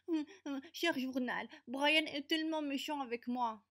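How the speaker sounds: high, whiny mock reading; mocking diary voice. Bully reading her notebook aloud in a high mocking voice. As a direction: sneer it like a pathetic diary entry.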